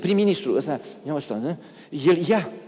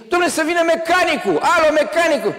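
high, loud shout for the mechanic; calling for help; 11 semitones higher, 5 dB louder than usual. Retold or imitated. Stuck in the lift, shouting for the mechanic. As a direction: yell up the lift shaft.

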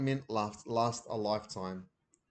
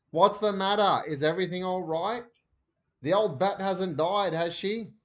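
Mum on the phone; 7 semitones higher, 5 dB louder than usual. A narrator's reading.

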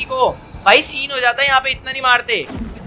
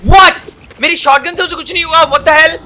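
shocked outburst; 5 semitones higher, 7 dB louder than usual. Real, not acted. Shotgun fails against monster.

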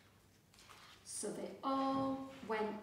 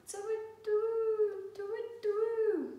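high, slow hooting owl; owl call; 12 semitones higher, 6 dB louder, much slower than usual. A narrator's reading. Owl in the night garden, high slow call. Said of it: "went the owl".

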